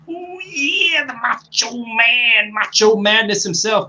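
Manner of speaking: high, strained wrestler catchphrase, drawn out; Macho Man. Retold or imitated. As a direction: stretch it out like a hyped-up wrestling star. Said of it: "his voice".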